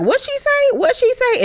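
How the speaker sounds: very high, stunned repeat; shocked question. Retold or imitated. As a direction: squeal it in disbelief. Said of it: "Garcelle was like".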